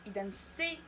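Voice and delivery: ordinary delivery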